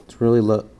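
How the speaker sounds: usual speaking voice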